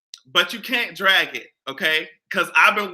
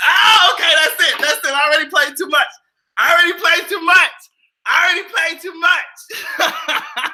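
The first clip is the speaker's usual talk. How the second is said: laughing shout; 10 semitones higher, 4 dB louder than usual. Real, not acted. Laughing, embarrassed excitement.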